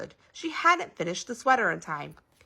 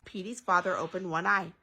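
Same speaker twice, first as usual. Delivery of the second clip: ordinary delivery, nothing marked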